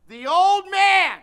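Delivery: put-on drawn-out loud old man; preaching emphasis. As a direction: proclaim it to the congregation. Preaching at full force.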